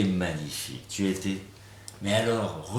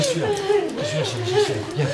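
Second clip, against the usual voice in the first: high loud soothing call; high-pitched reassurance; 5 dB louder, faster than usual. Real, not acted. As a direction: comfort her, pitched way up.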